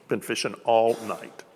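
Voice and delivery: slowed right down, each word weighed; preacher slowing to make them feel it; slow, letting it sink in. Shown as a demonstration. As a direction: let it land slowly with the listeners. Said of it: "just let that sink in".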